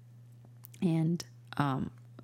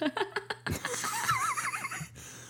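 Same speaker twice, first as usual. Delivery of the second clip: high burst of laughter. Real, not acted. Laughing at her own joke.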